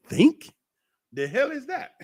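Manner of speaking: high, disbelieving echo of the word; a scoffing squeak of disbelief; incredulous. Real, not acted. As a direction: throw the word back as if absurd. Mocking his own advice.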